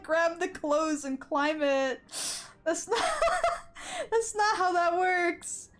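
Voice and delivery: ordinary talk, nothing marked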